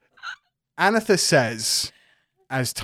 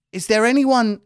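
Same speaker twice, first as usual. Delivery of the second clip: put-on GM's NPC drifting into a Geordie accent; slipping into Geordie; 10 semitones higher, 5 dB louder than usual. Announcer NPC asks the winners, slipping into Geordie. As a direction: Geordie creeping into the voice. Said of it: "Gone a bit Geordie then".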